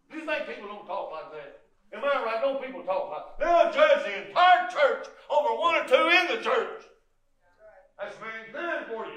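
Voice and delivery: high, loud shout of warning; full-force preaching. Acted. Preacher shouting at the congregation about gossip. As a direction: shout it out to the pews.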